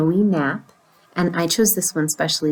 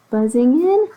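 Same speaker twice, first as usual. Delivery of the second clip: plain reading voice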